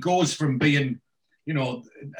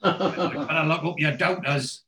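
higher, slow, amused broad Geordie; Geordie accent; 5 semitones higher, slower than usual. Retold or imitated. Repeating Oz's line for the listener, amused Geordie. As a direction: relish the broad Geordie vowels. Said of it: "that's a proper Geordie pronunciation".

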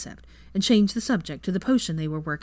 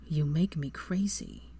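slow, hushed lover's whisper; breathless whisper; 2 semitones lower, 6 dB quieter, slower than usual. Acted. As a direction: breathe it out, dazed. Draco after kissing.

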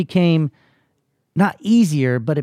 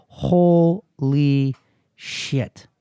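very slow, drawn-out awe; stunned realisation; much slower than usual. Retold or imitated. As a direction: stretch each syllable out as it sinks in. Retold moment of sudden realisation.

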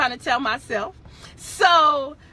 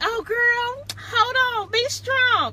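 higher, lightly voiced churchy friend saying "hold on"; mock holy friend; 9 semitones higher than usual. Teasing married Rhonda, a high mock-pious voice. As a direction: play the newly pious friend, a bit preachy. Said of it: "Now you married, you like".

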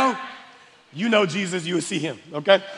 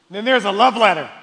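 ordinary delivery

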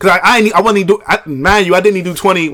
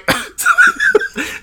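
cackling laugh, high pitched. Real, not acted. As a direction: cackle at the memory. Laughs recalling struggling stranger.

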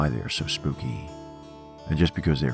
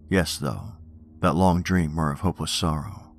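poem reading. Acted. Reads Poe poems aloud.

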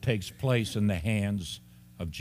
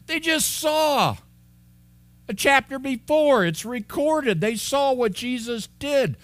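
much higher, disbelieving exclamation; incredulous; 12 semitones higher, 4 dB louder than usual. Real, not acted. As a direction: say it like you can't believe they forgot. Incredulous at disciples' forgetting.

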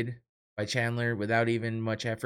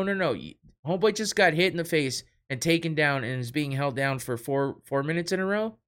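ordinary talk, nothing marked

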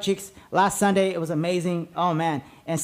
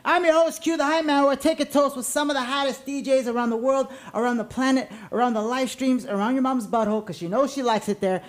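energy drink ad; 4 semitones higher than usual. Acted. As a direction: shout it like a party host. Podcast intro hype host.

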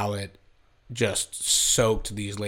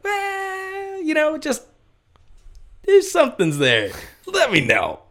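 casual shrug; 5 dB louder, slower than usual. Retold or imitated. Retold casual dismissive reply.

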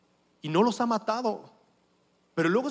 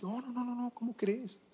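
embarrassed refusal; 3 semitones higher, 10 dB quieter than usual. Retold or imitated. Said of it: "entonces dices".

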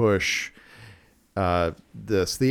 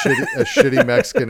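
laughing through the words; 4 dB louder, faster than usual. Real, not acted. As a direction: giggle while refusing. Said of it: "I am not going to attempt".

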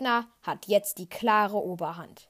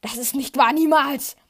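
loud, rising to a cry on niemals; panicked shout; 3 semitones higher, 9 dB louder than usual. A narrator's reading. Luke screams his denial at Vader. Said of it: "sagt Luke panisch".

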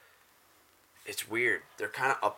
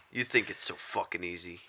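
ordinary talk, nothing marked

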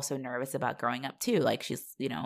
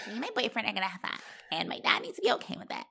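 high, fast mocking whine; mocking Emma; 6 semitones higher, 6 dB quieter, much faster than usual. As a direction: mimic a spoiled teen begging her dad. Mocking Emma's whiny demand.

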